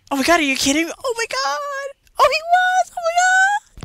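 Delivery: very high, loud squeal of disbelief; freaking out, voice shooting way up; excited squeal. Real, not acted. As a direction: squeal with thrilled amazement. Hears thrilling news about the game.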